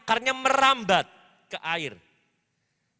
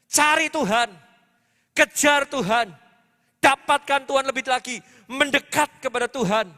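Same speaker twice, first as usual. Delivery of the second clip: raised, louder preaching in punchy phrases; rousing exhortation; 4 semitones higher, 5 dB louder than usual. Real, not acted. Preacher urging congregation to seek God this year, rousing exhortation. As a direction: drive each command home to the congregation.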